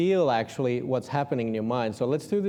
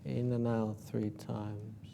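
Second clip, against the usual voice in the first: guided meditation; 8 dB quieter than usual. Acted.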